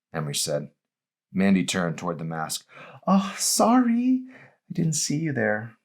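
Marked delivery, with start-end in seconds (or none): 3.1-5.8 much higher, slow, mock-innocent apology; dripping with fake sincerity; fake sorry. Mandy voiced.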